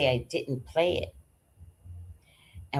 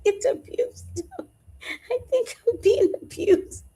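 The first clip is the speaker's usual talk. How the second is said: high, whining fake sob; high mock-wounded wail; mock crying. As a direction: ham up the tearful victim. Mock complaint about cat abuse.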